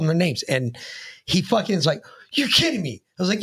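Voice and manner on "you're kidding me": high-pitched imitated disbelief. Teller voicing the man hearing the names, incredulous. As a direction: act out incredulity, pitch jumping up.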